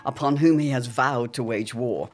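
measured radio-serial recap narration; dramatic narrator. A narrator's reading. Narrator recapping the Pembrokes' story for listeners, ominous. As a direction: set up the danger like an old radio announcer.

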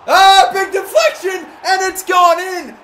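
very high, loud goal scream that cracks; voice cracking shout. Real, not acted. Gamer scoring in the cup final, cracking high shout. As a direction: scream the goal until the voice breaks. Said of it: "Big voice crack".

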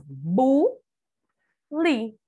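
BU, pause, LI; each syllable of bule on its own; separated syllables. Shown as a demonstration. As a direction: say Bu and li as two isolated syllables. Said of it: "Sílabas".

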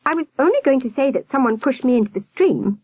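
put-on high-pitched boyish complaint; complaining boy. A narrator's reading. Albert protesting at being kicked, complaining child. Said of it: "Albert complained".

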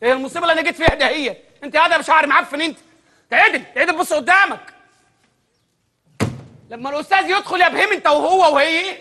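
ordinary talk, nothing marked

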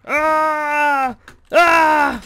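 loud, high held yell; war cry. Pretend. Player charging at the British, war cry.

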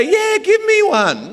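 put-on high, slow little-kid voice; lightly hammed-up child answering; mock kid. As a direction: play a naive child asking for it. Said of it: "what's a kid gonna say".

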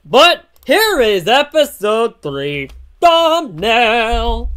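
loud, high, drawn-out announcement; excited reveal. Real, not acted. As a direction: present it like a big unveiling. Hyped reveal of thumbnail.

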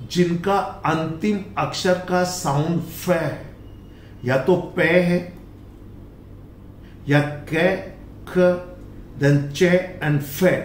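ordinary delivery

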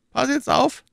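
höher gesprochener, drängender McFarland; überredet den Freund mit Nachdruck; McFarland, pushing hard. Acted. McFarland voiced. (McFarland drängt Fettes, höhere eindringliche Stimme)

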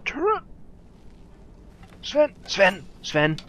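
loud frantic shouts of his name; yelling at companion. Real, not acted. Gamer yelling at companion Sven mid-fight, frantic shouting.

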